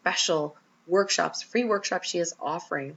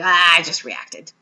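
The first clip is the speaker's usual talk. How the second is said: frustrated sigh; 11 dB louder, slower than usual. Retold or imitated. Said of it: "you're just like".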